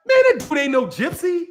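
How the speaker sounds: high, indignant disbelief; incredulous retort. Pretend. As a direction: scoff like a fed-up local fan. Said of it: "you'd be like".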